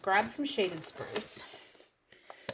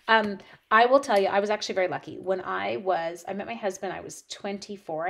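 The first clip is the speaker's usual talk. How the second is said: ordinary talk, nothing marked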